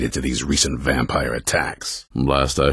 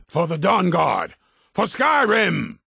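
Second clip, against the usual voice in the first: high, ringing rallying shout; shouted war cry, high and slow on the second; battle cry. Acted. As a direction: rouse the troops to charge.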